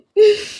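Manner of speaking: sob-laugh breaking high; laughing through tears; crying laugh. Real, not acted. Surprised by her own tears, sob-laugh. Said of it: "why did this just make me emotional".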